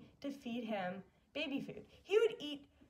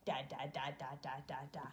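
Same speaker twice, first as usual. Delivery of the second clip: much lower, half-whispered "Da, da, da"; imitating baby babble; 9 semitones lower, 6 dB quieter, faster than usual. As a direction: babble like the baby, low and hushed. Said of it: "It's just, like".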